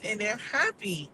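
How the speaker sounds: usual speaking voice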